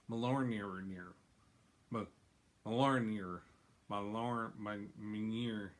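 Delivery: "Mjolnir" fumbled slowly as "ma-LORN-eer"; stumbling pronunciation. Real, not acted. Host struggles with the hammer's name, stumbling over "Mjolnir" as "ma-LORN-eer". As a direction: trip over "Mjolnir", breaking it into false starts. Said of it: "do you know how to say that?".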